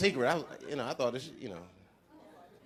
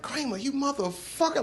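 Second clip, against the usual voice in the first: hurt cursing; 8 semitones higher, 5 dB quieter than usual. Retold or imitated.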